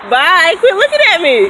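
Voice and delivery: high, loud, giggling brush-off; laughing as she shoos off a staring man; giggly tease. Real, not acted. Flirting with a stranger.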